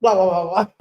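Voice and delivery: low, garbled put-on senator voice; blah-blah parody of proper talk; mock formal candidate. Host mocking how a senator supposedly must talk, mock formal. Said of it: "You have to talk like".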